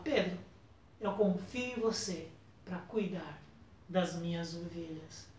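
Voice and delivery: entrust him gently and quietly; Jesus softly reassuring Peter of his trust; tender reassurance. Retold or imitated.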